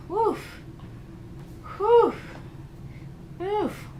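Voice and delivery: high playful woof barks; dog woof. Pretend. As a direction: bark like a little dog. Barking at boyfriend.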